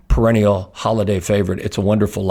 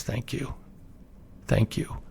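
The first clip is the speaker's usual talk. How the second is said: breathy near-silent thank you, choked; hushed, tearful whisper; 3 semitones higher, 12 dB quieter than usual. Real, not acted. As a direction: barely get the words out.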